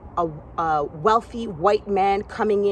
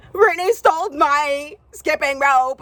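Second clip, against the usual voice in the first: high, louder tattling whine; mock crying complaint; 6 semitones higher, 5 dB louder than usual. Girl tattling to the owner, hammed-up tearful whine. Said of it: "she's crying".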